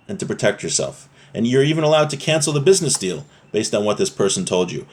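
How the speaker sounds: normal talking voice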